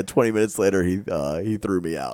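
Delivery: normal talking voice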